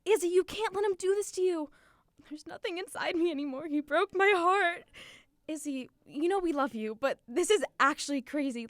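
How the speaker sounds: high, loud, mock-panicked friend voice; imitating friends freaking out over the phone; frantic friends. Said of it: "it sounded something like".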